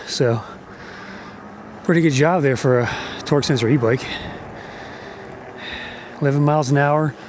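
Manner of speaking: normal talking voice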